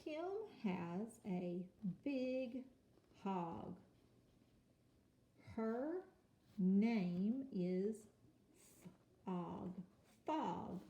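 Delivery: slow reading aloud. Shown as a demonstration. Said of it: "Let's read the first line together".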